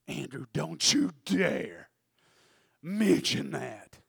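slow, strained emphatic warning; stern self-warning. Acted. Andrew fighting his urge to speak, strained warning. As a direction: stretch each word as a threat.

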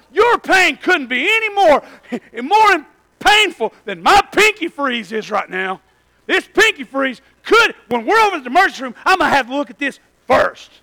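one-up her labor pains loudly; mock complaining. Husband one-upping his laboring wife, loud mock rant. Joking rant about pinky freeze.

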